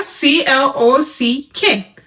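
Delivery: C L O C K, each letter said separately and slowly; spelled out letter by letter. Shown as a demonstration. Teacher spelling C L O C K for sign students. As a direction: spell C L O C K one letter at a time. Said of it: "Or you spell clock".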